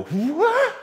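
a very high, loud startled cry; shocked yelp. Retold or imitated. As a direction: yelp in alarm at a friend's stunt. Ryan sees narrator slide off too.

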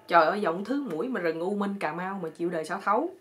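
usual speaking voice

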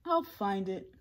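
plain reading voice

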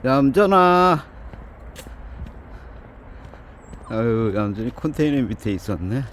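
high affectionate pet talk to the cat; cooing to a cat. Real, not acted. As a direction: croon up high to a beloved stray cat. Man cooing at cat Yamjeoni.

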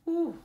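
high loud whew of fear; a high gasped whew of dread; scared whew. Real, not acted. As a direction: let out a sharp high whew. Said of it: "I'm scared".

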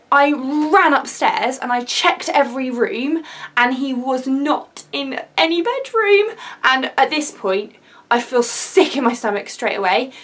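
ordinary delivery

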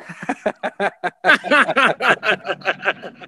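amused laugh at a joke title; real laugh. Real, not acted. Cohost laughing at his own song title, real laugh. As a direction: laugh out at the idea.

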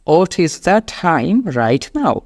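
very slow, airy T in "time"; "What" as "ot", "time" with a puffed T; aspirated T. Shown as a demonstration. Teacher shows learners, slow "time" with aspirated T. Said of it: "এইভাবে বিষয়টা খেয়াল করবেন".

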